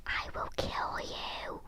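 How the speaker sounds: slow, drawn-out whispered threat; hammed-up sinister whisper; menacing whisper. Imitating an Xbox fanboy's invented threat, slow whisper.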